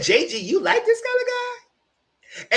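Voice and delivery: very high, slow squeal of disbelief; a high, slow squeal asking a friend about her taste in men; incredulous. Retold or imitated. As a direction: drag it out high like you can't believe it. Incredulous retold question.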